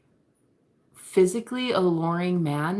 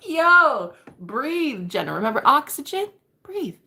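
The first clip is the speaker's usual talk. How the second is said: higher-pitched mock coaxing; imitated concerned crush; 7 semitones higher, 5 dB louder than usual. Crush reminding his flustered admirer to breathe, mock concern. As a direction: coax someone to breathe, hammed-up. Voices crush calming her down.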